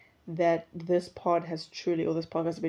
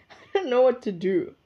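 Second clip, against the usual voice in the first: laughing; 5 semitones higher, 4 dB louder than usual. Real, not acted. Laughing at own confusion.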